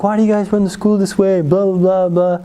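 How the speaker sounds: put-on indignant kid ranting; giving a piece of his mind. Kid tells off the school counselor, mock indignant. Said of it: "give him a little bit of peace in my mind".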